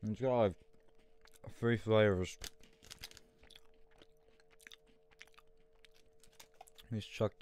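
chewing while talking; eating a wafer bar; mouth full. Real, not acted. Seen 0: bites bar at 1.4–2.5, then talks head-down while likely chewing.

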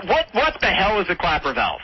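ordinary delivery

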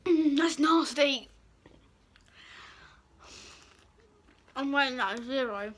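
chewing with hand over mouth; speaking while removing food; mouth full of food. Real, not acted. Seen 4: Hand covers mouth, fingers pulling food out at 3.0–3.7s.

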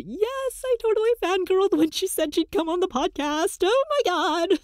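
high, quick excited squeal into a laugh; fangirl gushing. Real, not acted. Host thrilled that their idol will come on the podcast, giddy fangirling. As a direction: gush in a high voice, breaking into laughter.

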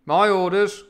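high, loud captain's declaration; captain's command. Acted. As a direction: call it out as the ship's captain. Captain's line repeated in command.

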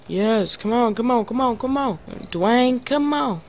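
high-pitched, repeated egging on; urgent urging. Real, not acted. Urging the play on.

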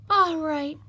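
high piglet voice with a touch of a sigh; sighed resignation. Acted. Piglet gives in to the fox, mildly sighing. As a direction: sigh it in reluctant surrender. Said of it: "sighed the piglet".

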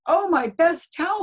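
high, loud dismay; dismayed cry. Acted. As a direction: gasp it out in dismay. Mrs Rogers dismayed at towel.

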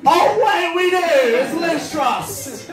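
high, drawn-out cry of delighted realisation; hammed-up thrill at a sudden discovery; mock excitement. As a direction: squeal it like wonderful news. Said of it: "I was so excited".

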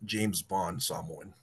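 low, slow suave Western Samoan accent; James Bond Samoan accent. Shown as a demonstration. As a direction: put on a smooth, slow Samoan spy accent. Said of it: "Western Samoa will sound like".

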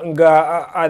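normal talking voice

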